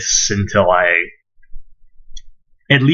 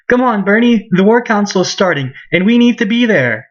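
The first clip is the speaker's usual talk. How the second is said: put-on higher-pitched stock game girl; generic girl voice; 11 semitones higher than usual. Annette calls Bernie to the War Council, generic girl voice. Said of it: "generic girl voice number two".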